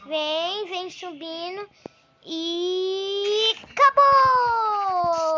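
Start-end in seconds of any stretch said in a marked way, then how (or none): 2.2-5.3 excited. Real, not acted. Ride ends.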